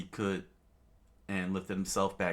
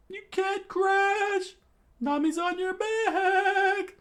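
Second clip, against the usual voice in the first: very high, loud, slow falsetto; squeaky singsong scold; 6 dB louder, slower than usual. Acted. Character warning Sanji about Nami, in a high singsong. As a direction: sing the scolding in a cartoon voice.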